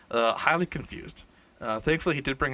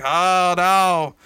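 mock dismayed cry; 5 semitones higher, 8 dB louder than usual. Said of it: "they're like".

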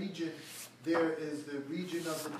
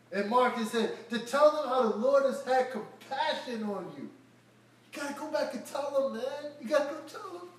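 fervent preaching; 6 semitones higher, 7 dB louder than usual. Acted. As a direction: preach it out to the crowd. Preaching at full force.

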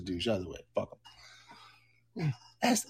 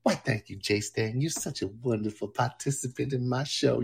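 Jessica character voice. Acted. Streamer plays his producer Jessica answering chat, sassy put-on voice. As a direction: play Jessica, the sassy producer, taking the mic. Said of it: "Here's Jessica".

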